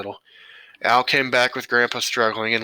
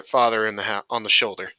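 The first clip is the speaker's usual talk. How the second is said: usual speaking voice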